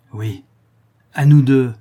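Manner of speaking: restrained yes, then a very loud threat; threaten, hitting the last words hard; menacing, forceful challenge. A narrator's reading. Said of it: "reprit-elle d'une voix menaçante".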